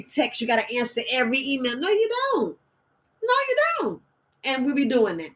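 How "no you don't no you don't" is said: very high-pitched mock protest; emphatic contradiction. Real, not acted. Coach rebutting the need to answer every email, high-pitched protest. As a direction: sing out the denial way up high. Rebuts the listed phone obligations.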